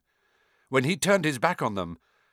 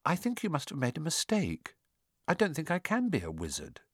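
soft, slightly raised pitch; said quietly; 3 semitones higher, 7 dB quieter than usual. A narrator's reading. Harry telling Hagrid he can't be a wizard, quietly. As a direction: quietly voice a doubtful boy. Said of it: "he said quietly".

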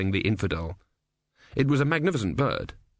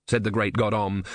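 plain reading voice

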